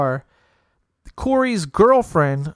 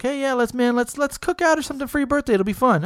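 higher, casual friendly invitation; upbeat invite; 8 semitones higher than usual. Retold or imitated. Friend inviting him to a birthday cookout, higher and casual. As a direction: sell the cookout like it's no big deal.

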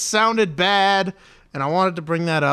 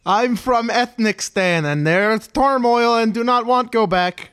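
put-on higher, broken-English accent; mock foreign accent; 6 semitones higher than usual. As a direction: play the panicked foreigner. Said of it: "As our resident ethnic".

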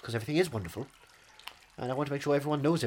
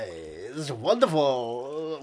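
very slow wonderful; much slower than usual. Pretend. Stretched comic drawl.

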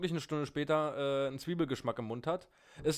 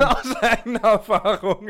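loud, high laughing repeat; 7 semitones higher, 13 dB louder than usual. Real, not acted. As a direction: crack up while saying it. Echoing joke while laughing.

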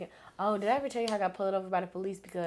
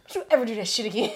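mock scolding; 3 semitones higher, 6 dB louder, much faster than usual. Officer scolding.

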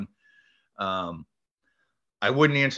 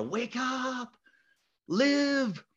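desperate plea; 14 semitones higher, much slower than usual. Retold or imitated. Slapping unconscious boy.

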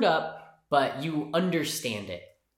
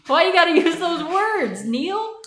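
laughing, much higher and louder complaint; exasperated whine; 9 dB louder, slower than usual. Real, not acted. A reader protesting the author's words, high disgusted whine. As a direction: wail it at the author in disbelief.

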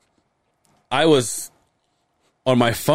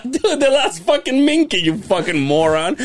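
laughing scold; 11 semitones higher than usual. Retold or imitated. George scolding him.

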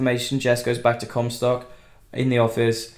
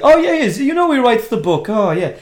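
high, loud, eager put-on voice; chatty old guy; 10 semitones higher, 6 dB louder than usual. Factory boss confirming the book, excited old-guy voice. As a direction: gush as an over-keen old man. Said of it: "like, an old guy".

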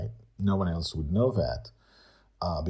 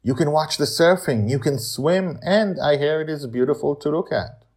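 higher, friendly character voice; lightly voiced Amali; 9 semitones higher, 6 dB louder than usual. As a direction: enthusiastic recommendation. Amali recommends the beach.

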